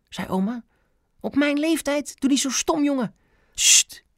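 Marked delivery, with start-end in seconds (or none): 1.2-3.1 put-on high-pitched indignant old lady; indignant scolding. A narrator's reading. Grandma rejects swimming through a sewer, indignant. 3.5-4.0 sharp, voiceless "shh"; shushing. A narrator's reading. Ben shushes his loud grandma. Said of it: "deed Ben".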